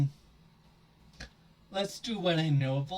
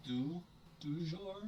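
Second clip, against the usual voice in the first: French phrase; 2 semitones higher, 8 dB quieter than usual. Real, not acted. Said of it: "I shouldn't try to speak French".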